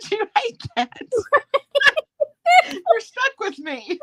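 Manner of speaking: high, laughing tease. Real, not acted. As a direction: tease through giggles. Teasing friend, laughing.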